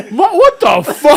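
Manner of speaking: high, loud, laughing disbelief; stunned disbelief. Retold or imitated. Friend recounts the runner's sudden dash, stunned disbelief. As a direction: retell a shocked outburst through laughter. Retelling his stunned reaction.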